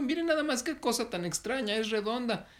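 high-pitched amazed exclamation; astonished story character. Acted. Heron finds a strange round thing, astonished. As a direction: marvel out loud in a high voice.

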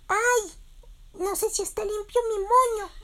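very high squeaky little chick fretting; tiny chick voice. Acted. The chick Berilio frets to his friends, in a very high squeaky voice. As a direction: squeak it out like a worried baby chick.

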